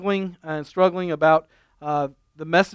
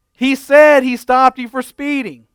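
loud repeating; 6 semitones higher, 6 dB louder, slower than usual. Retold or imitated. Husband repeats loudly to wife.

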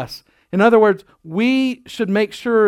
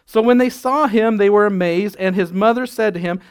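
ordinary delivery

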